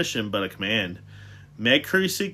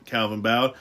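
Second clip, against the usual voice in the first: plain reading voice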